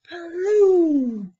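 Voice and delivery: high, loud mock gunshot; booming gun noise. A narrator's reading. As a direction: blast it out like a big gun going off. Gun goes off.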